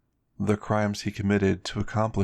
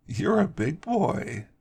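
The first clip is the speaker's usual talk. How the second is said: higher, slow teasing drawl; teasing Heather; 9 semitones higher, slower than usual. Acted. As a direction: stretch it out, playfully mocking. Heather teasing Brad at breakfast.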